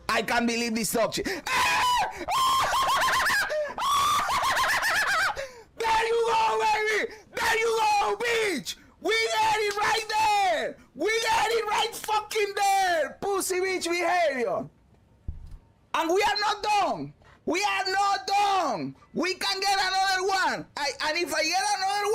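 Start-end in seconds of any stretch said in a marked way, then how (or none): 1.3-5.5 screaming high triumphant laugh; shrieking laugh of pure joy; ecstatic laugh. Real, not acted. Lucky drop on stream. 5.8-14.7 high, slow victory yells; triumphant shouting. Real, not acted. Celebrating the drop. 15.9-20.7 hyped shout. Real, not acted. Hyped after the win.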